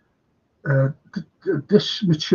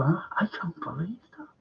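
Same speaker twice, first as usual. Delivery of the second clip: hushed disbelief; 3 semitones higher, 8 dB quieter than usual. Retold or imitated. Companion shocked by the snub.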